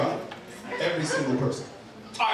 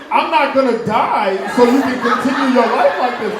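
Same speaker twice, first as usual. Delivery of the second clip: loud, raised comic protest; indignant refusal; 4 semitones higher, 8 dB louder than usual. Retold or imitated. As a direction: protest loudly to a freeloading dad. Continued outraged refusal to dad.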